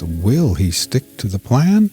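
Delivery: usual speaking voice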